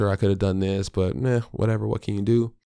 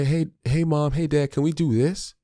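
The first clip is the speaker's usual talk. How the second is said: put-on higher, excited child's voice calling for mom and dad; eager kid asking; 7 semitones higher than usual. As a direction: play the kid tugging at a parent's sleeve. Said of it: "when they say".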